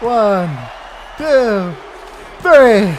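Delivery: high, loud excited count; excited shout. Real, not acted. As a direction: count it out, screaming. Counting the winning pin.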